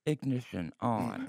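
slow, soft robot car voice. Acted. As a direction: announce it like a car computer. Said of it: "and I go".

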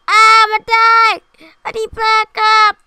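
high panicked scream for help; panicked yelling. Real, not acted. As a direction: yell for backup in panic. Dying in firefight.

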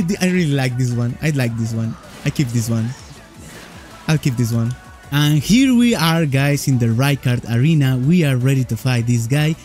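normal talking voice

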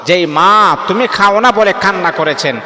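usual speaking voice

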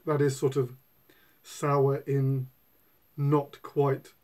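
ordinary talk

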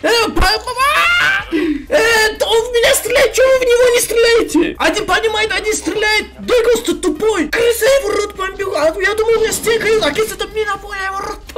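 shrill high-pitched gamer rage; raging gamer. Real, not acted. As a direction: squeal and yell at the game in frustration. Rages at enemy shooting him.

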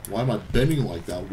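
low, soft questioning; low-pitched, restrained irritation; subdued bafflement. Real, not acted. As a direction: ask it under control, quietly puzzled. Baffled by the game character.

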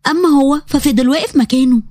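ordinary delivery

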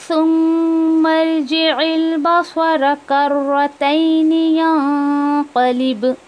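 slow, drawn-out melodic recitation; Quran recitation. Shown as a demonstration. Teacher recites the fourth verse for the class. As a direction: chant the verse, holding long vowels.

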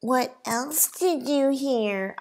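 high, slow sing-song taunt; mock him in a lilting sing-song; sing-songy mockery. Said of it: "I mock James in a sing-songy voice".

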